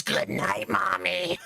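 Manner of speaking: imitating a toddler's eerie whispered goodnight; a light demon-child whisper; hint of a creepy gremlin whisper; creepy little voice. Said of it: "keep talking in that voice".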